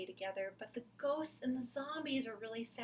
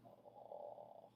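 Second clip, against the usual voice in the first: zombie moan; 17 dB quieter than usual. Acted. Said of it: "the zombies put up their little zombie thumbs and went".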